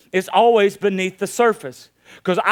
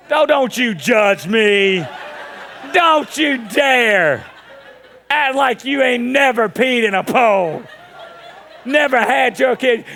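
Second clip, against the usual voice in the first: loud, drawn-out playful scolding; mock indignation; 4 semitones higher, 4 dB louder, slower than usual. Preacher telling the congregation not to judge him, hammed-up loud indignation. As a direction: scold them loudly, half laughing.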